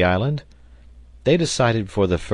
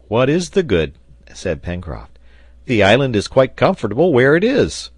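slightly raised, higher voice for a bluff sailor; lightly voiced hearty doubter; bluff objector; 5 semitones higher, 6 dB louder than usual. A narrator's reading. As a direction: brush it off in a higher voice. Pencroft's bluff objection, voiced in story.

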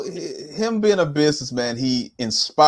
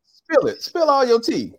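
high, teasing gossip push; sassy coaxing; 10 semitones higher than usual. Real, not acted. Host teasing guest to spill the tea, playfully high. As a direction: egg her on to spill the gossip.

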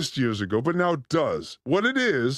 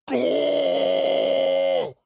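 long, rounder GAH, less high; chest-voice belt; 7 semitones higher, 3 dB louder than usual. Shown as a demonstration. Showing co-host the right placement, long chest belt. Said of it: "from your chest right here".

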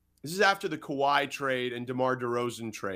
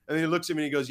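ordinary talk

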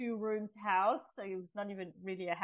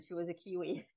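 ordinary talk

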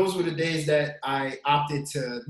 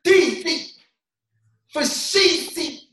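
hype intro; 9 semitones higher, 4 dB louder, slower than usual. Acted. Hyped intro rhyme.

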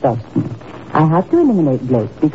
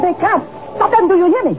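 panicked order; 11 semitones higher than usual. Acted. Villain panics as crowd arrives.

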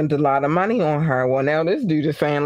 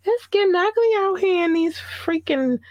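dramatic gossip; 11 semitones higher than usual. Real, not acted.

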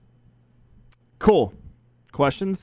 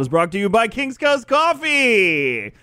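slow, high, louder announcer read; 11 semitones higher, 4 dB louder, slower than usual. Acted. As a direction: sell it like an ad.

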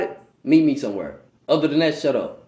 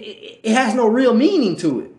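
ordinary talk, nothing marked

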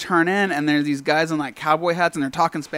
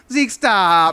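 mock yell, pitched up; imitated yelling out; 4 semitones higher, 4 dB louder than usual. Friend mimicking aunt in the background, mock yelling out. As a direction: call it out like a fed-up aunt. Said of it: "like, yelling out".

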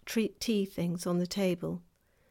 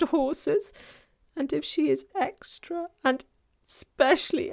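higher, miserable faun voice; beginning to cry; 6 semitones higher, 7 dB louder than usual. A narrator's reading. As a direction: lament dreadful punishments as the tearful faun. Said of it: "beginning to cry again".

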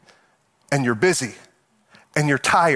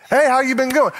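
higher, chirpy hello; bright greeting; 8 semitones higher than usual. Retold or imitated. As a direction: greet a friend brightly in passing.

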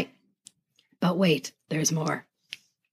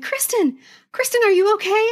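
high, concerned calling of her name; lightly voiced worried friends; worried classmates; 10 semitones higher than usual. Classmates voiced chasing after her.

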